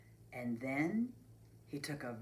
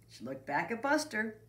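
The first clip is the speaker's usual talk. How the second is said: plain reading voice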